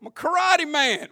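high, loud movie-quote voice; hammed-up comedian impression; Eddie Murphy. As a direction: belt out a comic boast. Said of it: "I tell you what Eddie said".

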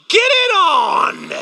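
high, slow, shouted cheer; gleeful shout. Retold or imitated. Preacher cheering God's snakes on, slow high shout. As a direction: yell it like egging on a fight. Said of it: "I'm like".

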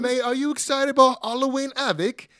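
high, loud put-on British accent; mock British accent. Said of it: "Yeah, British accent".

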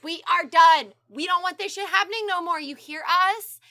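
loud, high, hammed-up warning; mock angry outburst. Agents warning smugglers at the border, mock angry outburst.

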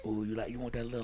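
low, soft, fast seductive murmur; smooth masseur voice. Retold or imitated. Masseur sweet-talking the client on the table, low and soft. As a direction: purr it like a slick masseur working a client.

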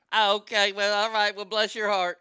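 loud imitated Southern lady; Southern lady's drawl. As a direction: mimic a Southern lady's polite put-down. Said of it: "Southern ladies like this".

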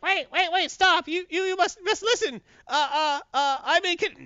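very high mock panic; choking villain begging, cut off; Brainiac. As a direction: plead in a hammed-up squeal, stammering. Brainiac being choked, pleading.

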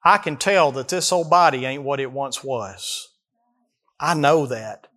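ordinary delivery

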